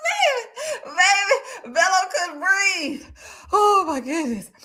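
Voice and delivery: high, slow words through crying laughter; laughing. Real, not acted. Host reacting to the chat, crying with laughter. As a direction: laugh till it hurts.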